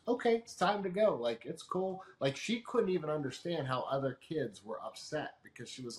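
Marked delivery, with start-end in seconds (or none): 0.0-2.0 put-on high, bright, carefree child voice; easygoing little girl. Daughter calmly agreeing to leave the park, high carefree child voice. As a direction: imitate a kid happily leaving the park.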